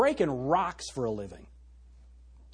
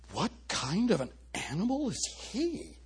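imitating a baffled onlooker; puzzled, halting question in a put-on voice; mock bewilderment; 4 semitones higher, 7 dB quieter than usual. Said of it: "but in the broader culture".

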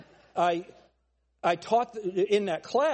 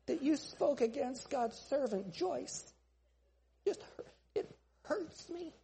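tiny, high, soft hurt voice; hammed-up delicate hurt, halting; mock wounded; 5 semitones higher, 9 dB quieter, slower than usual. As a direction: whimper it like a pious, offended church member. Acts out wounded letter writer.

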